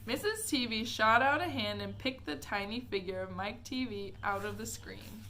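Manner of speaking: usual speaking voice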